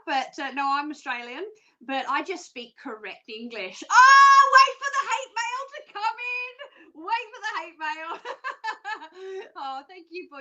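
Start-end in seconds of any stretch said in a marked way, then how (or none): 3.9-4.5 a high, loud burst of "Oh"; sudden loud oh, half laughing; loud exclamation. Real, not acted. Reacting to hate mail joke. 4.6-6.5 mock dread. Joking about hate mail.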